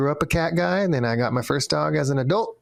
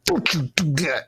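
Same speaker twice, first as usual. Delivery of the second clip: stern scolding; 3 semitones higher than usual. Shown as a demonstration. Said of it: "Can you say that again?".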